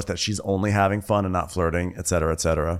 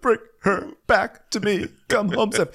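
mock sobbing; 12 semitones higher than usual. Groom sobbing on the bed for his fiancée, mock weeping. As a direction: plead through exaggerated fake sobs. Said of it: "he's just sobbing on the bed".

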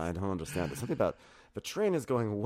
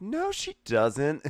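slow, higher drawn-out denial; mock denial; 8 semitones higher, 3 dB louder, much slower than usual. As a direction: stretch out the naive denial. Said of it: "He's like".

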